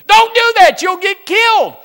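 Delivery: high, loud yelled warning; shouted warning. Retold or imitated. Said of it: "He said".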